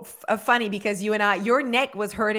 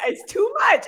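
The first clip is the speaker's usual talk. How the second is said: high squeal of delight; gushing; 11 semitones higher, 5 dB louder than usual. Real, not acted. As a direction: squeal it like it's unbearably cute. Gushing over a cute kid.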